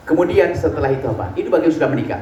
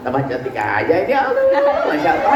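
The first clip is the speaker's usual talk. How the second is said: slow, higher, doting sweet talk; mock romantic husband; 6 semitones higher, slower than usual. A romantic husband sweet-talks his wife, slow and doting. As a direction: croon endearments slowly like a smitten husband. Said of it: "omongannya indah, mesra".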